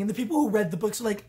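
usual speaking voice

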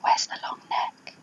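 hushed aside whisper. Real, not acted. Reader whispering an aside to the child, unvoiced. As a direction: breathe it softly to the listener.

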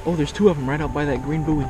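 ordinary delivery

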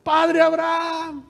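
high, sustained cry from far below; drawn-out acted wail for attention; distant shouting. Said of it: "alguien que les está gritando".

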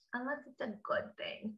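whispered aside. Acted. Reading aloud, dropped to whisper.

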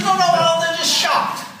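high, loud no no no; insistent brother. Retold or imitated. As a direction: insist loudly like the panicky brother. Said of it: "He said".

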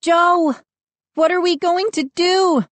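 high, panicky plea; frightened. A narrator's reading. Mindy calling to her brother over chants, frightened. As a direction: cry out in fright for help. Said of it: "I heard Mindy's frightened voice".

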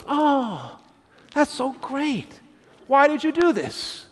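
high, delighted wife, a touch surprised. Retold or imitated. Wife opening the door to flowers, delighted and surprised. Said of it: "then she goes".

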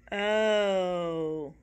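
groaned. A narrator's reading. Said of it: "groaned the children".

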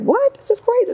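very high rising what, this is crazy; high incredulous outburst; shocked disbelief. Retold or imitated. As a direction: squeal it in disbelief. Shocked reaction quoted.

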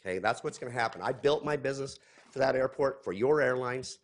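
usual speaking voice

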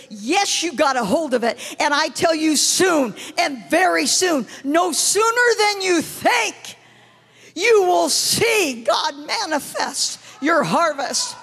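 loud, rising, slowing proclamation; prophetic declaration. Retold or imitated. Preacher retells prophesying to a woman outside the restroom, building to a shout. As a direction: declare it forcefully, stretching the last words out.

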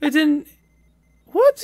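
a high, loud, disbelieving what; shocked what. Real, not acted. Player stunned her turtle died at full health, high and loud. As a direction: blurt it out in sudden shock.

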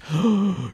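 sharp high gasp. Retold or imitated. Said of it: "he kinda goes".

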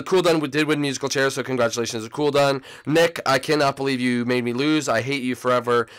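ordinary talk

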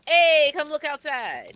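very loud, high-pitched holler; excited calling out. Real, not acted. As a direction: shout for someone to come and see. Calls partner to come and look.

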